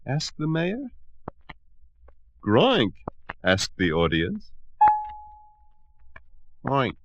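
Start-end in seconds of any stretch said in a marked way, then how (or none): 2.4-2.9 puzzled grunt. Acted. Audience puzzled. 6.6-7.0 small grunt. Acted.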